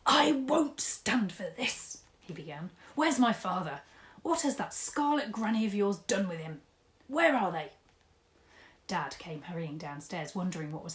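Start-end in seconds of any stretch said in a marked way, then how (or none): 0.1-2.0 whisper. Acted. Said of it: "pumps his arms up and down and shouts". 2.9-7.7 loud, furious questions; angry demand. Acted. Angry Mister Tugg demanding to know where his father is.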